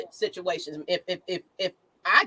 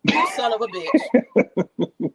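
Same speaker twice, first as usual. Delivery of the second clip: louder, slow, drawn out, breaking into a laugh; laughing outraged curse; 5 dB louder, much slower than usual. Retold or imitated. Still retelling his reaction to the tweet, now slow and laughing. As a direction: let the swear stretch out as the laughter takes over. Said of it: "I was like".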